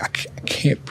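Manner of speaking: crying whisper. Retold or imitated. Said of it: "And I'm crying".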